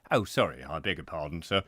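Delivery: mock-sheepish agent; dropping the accent. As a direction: apologise in a low, subdued voice. Agent drops silly accent, apologises.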